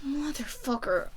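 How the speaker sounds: muted curse. Real, not acted. Reader cursing the love triangle, muted exasperation. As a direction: let it slip out quietly.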